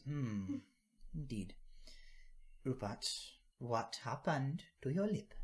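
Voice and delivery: patient, probing question; knowing granny. Acted. Granny coaxing the truth from the boy, slow and knowing.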